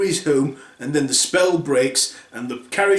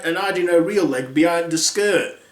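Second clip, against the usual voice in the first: usual speaking voice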